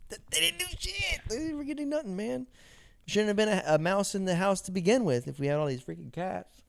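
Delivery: mock-whiny protest; high mock complaint. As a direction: whine about the useless cats. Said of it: "And I'm like".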